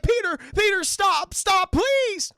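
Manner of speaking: high, loud mock pleading. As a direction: beg him to stop, shrill with panic. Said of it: "till the voice is like".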